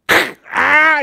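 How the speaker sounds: sudden high screeching squeal of a rusted bolt; imitating the rusted bolt breaking loose. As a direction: screech like metal finally giving way. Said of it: "and it screeches".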